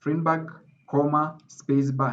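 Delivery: comma, space bar said slowly, each word set apart; slow emphasis. Shown as a demonstration. Lecturer slowing down so students catch comma, space bar. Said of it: "to emphasize that it's Finberg".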